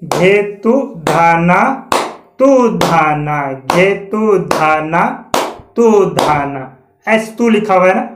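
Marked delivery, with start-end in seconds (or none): 0.0-6.8 slow bol recitation. Shown as a demonstration. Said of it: "जैसे मात्राएं चल रही है".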